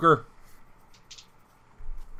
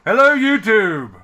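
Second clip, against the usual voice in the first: loud, upbeat YouTube host; show host intro; 5 semitones higher, 6 dB louder than usual. Acted. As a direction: welcome the viewers with gusto.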